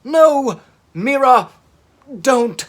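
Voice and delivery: very high, loud cry of alarm; horrified yell. Acted. Tegs seeing Mira attack the sub, loud horrified yell. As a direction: scream a warning.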